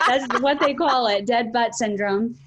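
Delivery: giggling through the words; laughing. Real, not acted. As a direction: laugh as you say it. Laughing at dead butt.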